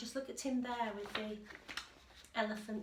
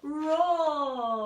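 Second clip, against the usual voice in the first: big, loud roaring "roar."; lion roar; 6 semitones higher, 15 dB louder than usual. A narrator's reading. Teacher reading, roaring loudly as the lion. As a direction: let the word roar out like a lion. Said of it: "by how loud he could".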